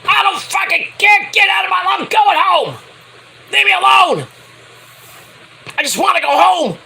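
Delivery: high, loud furious yell; Cameron Grimes yelling. Retold or imitated. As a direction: scream it at him while storming off. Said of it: "Cameron's like".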